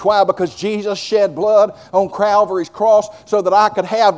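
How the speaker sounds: loud, slow, fervent preacher's drive; preaching at full force. Acted. Preacher to congregation, fervent loud preaching.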